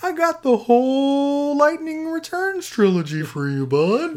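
slow, dreamy, high Phil impression; sedated. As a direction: lull him in like a sleepy salesman. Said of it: "You sound really sedated".

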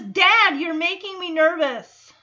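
high, loud mock protest; exasperated daughter. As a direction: imitate a flustered kid complaining to dad. Retold daughter protesting to dad.

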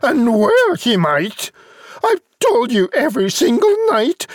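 very high, hammed-up nagging mum; exasperated high-pitched mother rant; scolding mother. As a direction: tell the boy off at high pitch. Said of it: "The mother said".

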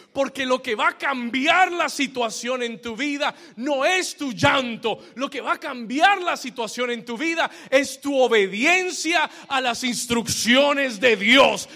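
loud, high, shouted proclamation; full-force preaching. Real, not acted. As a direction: build to a booming sermon climax. Preacher shouts the climax to congregation.

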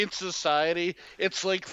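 ordinary talk, nothing marked

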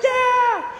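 high happy whoop; joyful cheer. Retold or imitated. Man cheering with the kids, high happy whoop. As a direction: cheer along with them.

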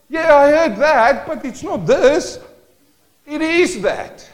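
high, loud and slow; acted excuse. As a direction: stretch out a whiny excuse.

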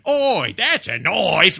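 hammed-up Australian accent, high and drawn out; Max Martini's fake Australian. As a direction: stretch it into a cartoon Australian bellow. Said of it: "Max Martini going".

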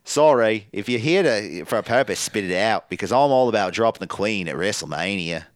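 put-on Australian accent; exaggerated Australian imitation; Rhea Ripley. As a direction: redo the wrestler's line in broad mock Aussie. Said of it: "that's why Rhea Ripley impersonates".

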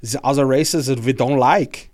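heavy mock German-Austrian accent; Arnold Schwarzenegger. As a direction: pitch a plan in a put-on Austrian accent. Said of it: "Arnold Schwarzenegger, how did you".

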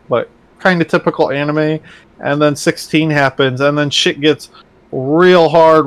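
ordinary delivery

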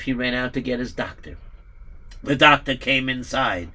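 ordinary delivery, nothing marked

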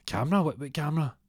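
high alarmed question; startled thug. Retold or imitated. Gangster startled to learn of a camera.

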